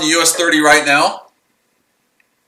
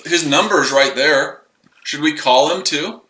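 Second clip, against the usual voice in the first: ordinary talk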